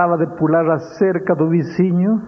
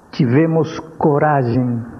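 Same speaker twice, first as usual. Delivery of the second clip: normal talking voice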